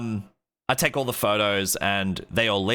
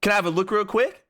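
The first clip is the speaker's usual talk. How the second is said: high, put-on bro voice; hammed-up high bro voice; mock bro request; 10 semitones higher, faster than usual. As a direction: ask it in a squeaky imitation. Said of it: "He's like".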